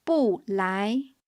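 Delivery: "bu" falling sharply before rising "lai"; fourth-tone bu. Shown as a demonstration. Teacher showing the tone of bu, "bu" falling before "lai". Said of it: "Bu is a fourth tone syllable".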